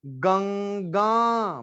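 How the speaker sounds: गंगा drawn out slowly, nasal before the g; slow pronunciation demo. Shown as a demonstration. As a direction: stretch गंगा out for the class. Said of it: "ये ऊपर यहाँ भी बिंदी लग गई". (गंगा धीरे-धीरे बोलकर दिखाना)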